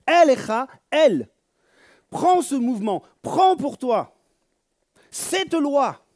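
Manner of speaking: impassioned sermon call; fervent preaching. Acted. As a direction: urge them to take it. Preaching exhortation.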